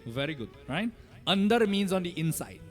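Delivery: ordinary talk, nothing marked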